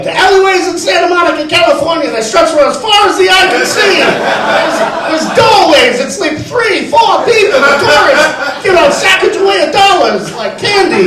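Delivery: put-on high, loud, fast-talking old-time gangster; forties gangster voice. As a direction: sell it like a 1940s wise guy. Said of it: "talk like forties gangsters".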